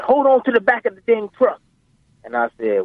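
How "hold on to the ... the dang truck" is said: high-pitched imitation of dad's command; dad ordering. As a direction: give the order as an insistent dad. Retold dad giving an order.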